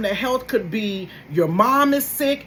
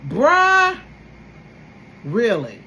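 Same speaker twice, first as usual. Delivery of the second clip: exasperated disbelief; 4 semitones lower, 4 dB louder, much slower than usual. Retold or imitated. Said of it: "I'm like".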